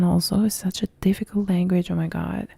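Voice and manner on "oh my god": usual speaking voice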